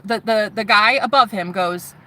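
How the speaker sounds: usual speaking voice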